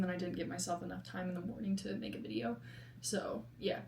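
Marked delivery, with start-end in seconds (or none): none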